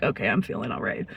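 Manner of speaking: drugged drowsy calm. Retold or imitated. Gabapentin kicking in, drowsy.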